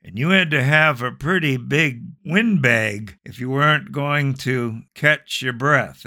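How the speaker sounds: ordinary talk, nothing marked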